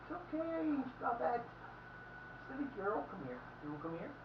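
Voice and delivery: high, soft voice for a pet. Real, not acted. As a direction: coo at the dog in a high voice. Baby talk to his dog.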